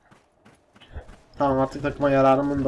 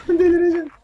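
much higher, louder cry of frustration; exasperated shriek; 5 dB louder than usual. Real, not acted. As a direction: snap in frustration. Frustrated gamer outburst.

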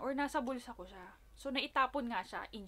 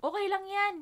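higher, louder comforting quote; sister reassuring; 8 semitones higher, 6 dB louder than usual. Retold or imitated. Sister comforting her, higher louder imitation. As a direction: brush off the worry like the big sister. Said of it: "sasabihin ko sa kanya".

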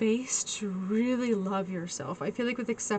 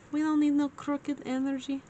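higher put-on voice; playful mock voice; 5 semitones higher than usual. Reader joking about a crooked setup, playful mock voice. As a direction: repeat it in a higher, silly voice. Mock repeat of own phrase.

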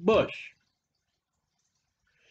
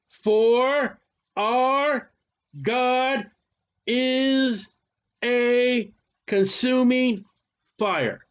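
word by word, slowed, weighty; each word set apart, slow and grave; solemn scripture reading; 2 semitones higher, much slower than usual. Acted. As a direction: read the verse like a proclamation. Reads Hebrews verse solemnly.

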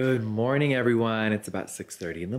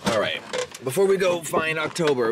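ordinary delivery

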